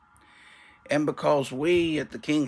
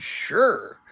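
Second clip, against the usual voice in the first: much higher, louder dismissive sure; curt annoyed sure; 7 semitones higher, 6 dB louder than usual. Retold or imitated. Annoying dryer salesman answering the customer, curt and dismissive. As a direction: toss off the word Sure like he can't be bothered. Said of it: "He said".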